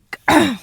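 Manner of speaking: sharp choking cough. Real, not acted. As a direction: cough on a swallowed crumb. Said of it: "Je suis en train de m'étouffer".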